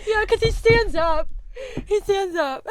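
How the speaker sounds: high, loud, through laughter; laughing defence. Real, not acted. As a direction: insist loudly while cracking up. Laughing, defending the sudden shouted quote.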